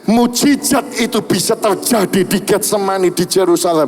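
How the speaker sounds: preaching a miracle testimony. Acted. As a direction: proclaim the healing to the congregation. Membacakan kesaksian mukjizat dengan semangat berkhotbah. (berkhotbah berapi-api)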